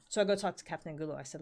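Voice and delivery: ordinary delivery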